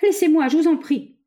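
high-pitched desperate plea; distressed cry. A narrator's reading. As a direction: beg to be let go, crying out. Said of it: "cria le malheureux savant".

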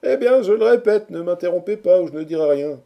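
high, forceful retort to hecklers; loud protest. A narrator's reading. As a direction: insist loudly over the interruption. Orator protests at being interrupted. (protestation forte, d'une voix haute)

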